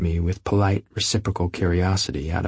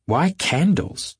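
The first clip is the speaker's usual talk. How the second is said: objecting; 9 semitones higher, much slower than usual. Acted. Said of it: "objected Daisy, frowning".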